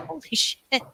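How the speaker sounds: mock-stunned quote broken by a laugh; retold amazement. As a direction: imitate the stunned guide, laughing through it. Retold tour guide's amazed reaction.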